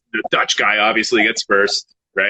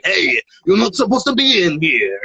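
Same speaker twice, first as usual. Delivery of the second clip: higher-pitched put-on voice of an Italian friend; 8 semitones higher than usual. Italian friend telling off the rowdy train group, imitated voice. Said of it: "our loud Italian friend is like".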